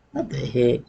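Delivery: quiet surprised aside; stumbling cold read. Real, not acted. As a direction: mutter at own misreading. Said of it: "I'm reading it wrong".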